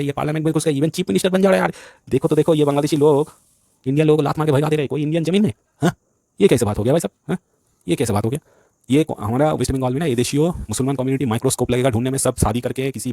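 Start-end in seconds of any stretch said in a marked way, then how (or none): 0.0-7.1 pitched well up and louder, ranting; heated rant. Real, not acted. Ranting to viewers about politicians, high indignant outburst. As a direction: work yourself up into an indignant outburst.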